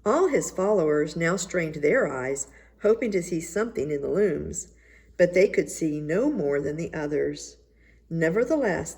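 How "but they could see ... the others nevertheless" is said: usual speaking voice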